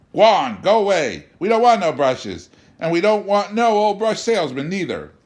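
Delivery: high, loud, mocking child's taunt; taunting boy. A narrator's reading. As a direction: jeer at him like a bratty kid. Boy mimicking father, taunting.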